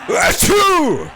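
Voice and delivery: sudden loud real sneeze. Real, not acted. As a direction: sneeze sharply into the mic.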